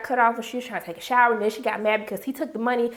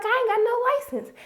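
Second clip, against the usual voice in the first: put-on high kid voice; 10 semitones higher than usual. Son's excuse voiced high.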